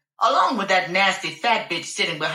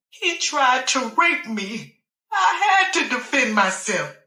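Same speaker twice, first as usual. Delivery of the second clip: tearful confession; 4 semitones higher than usual. Acted.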